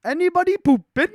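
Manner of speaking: put-on high, loud old Scottish farmer call; Scottish farmer impression. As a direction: shout it through the bathroom door in a thick Scottish accent. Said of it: "I can't do the voice that well".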